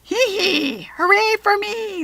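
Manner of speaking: high, loud, gleeful goblin squeal; hooting goblin. A narrator's reading. Goblin holding the apple up, hooting gleefully. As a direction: hoot with mischievous glee. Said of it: "the goblin hooted".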